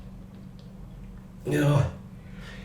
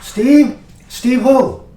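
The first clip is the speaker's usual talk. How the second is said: high, loud puzzled phone query; 8 semitones higher, 6 dB louder, much slower than usual. Acted. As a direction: ask loudly, as if baffled by the caller. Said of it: "Rick's there".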